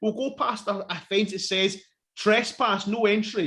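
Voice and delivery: high-pitched sarcastic imitation of a foolish bright idea; mocking their thinking. Mocking the film kids' plan to pass the fence, sarcastic. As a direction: mimic the idiots' reasoning with exasperation. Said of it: "And you're thinking".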